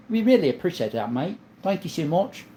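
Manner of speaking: keeps up the light put-on Australian accent, "mate" rising; pleased by the compliment, thanks the chat in a jokey Australian accent; a touch of Australian accent; mock Australian accent. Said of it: "Your accent is point on".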